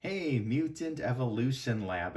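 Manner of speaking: usual speaking voice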